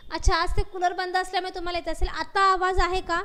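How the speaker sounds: speaking up over a weak line. Real, not acted. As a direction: raise the voice so the class can hear. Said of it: "आवाज कमी येतोय का?".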